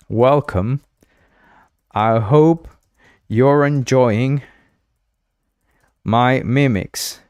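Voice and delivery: spaced-out words with long pauses; slow, deliberate greeting. Real, not acted. Streamer greets a viewer who can't hear, slow and deliberate. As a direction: drag out each phrase deliberately.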